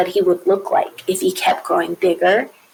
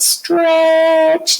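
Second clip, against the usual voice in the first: elongated, higher-pitched STRETCH; drawn-out stretch; 6 semitones higher than usual. Acted. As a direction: STRETCH held long on a high pitch. Acting out stretching himself.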